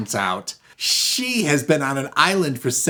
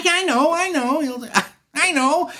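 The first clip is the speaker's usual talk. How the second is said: very high, loud, stuttering; flustered judge; 5 dB louder than usual. Acted. Judge trying to recognise the couple in court, flustered. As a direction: stammer it at a squeaky pitch. Judge tries to place them.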